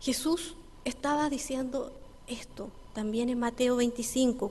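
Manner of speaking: ordinary talk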